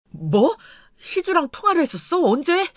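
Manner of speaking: high, startled questions; incredulous, pitch jumping up; startled disbelief. Acted. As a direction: react as if blindsided by the news. Husband learns of the phone call.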